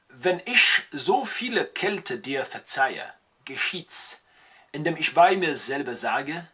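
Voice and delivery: slow, drawn-out German verse; slow German poetry recital; German poem recital. Acted. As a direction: improvise the sonnet in German, slowly. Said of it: "je vais l'improviser en allemand".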